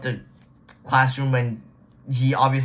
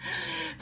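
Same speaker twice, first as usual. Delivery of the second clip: high stifled laugh; 12 dB quieter than usual. Real, not acted. Laughing at the story.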